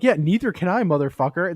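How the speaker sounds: high, loud jeering comeback; mock shout. Firing back at the farting coworker, loud and high. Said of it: "And I'm like".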